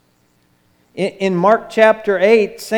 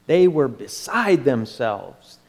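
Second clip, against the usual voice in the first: normal talking voice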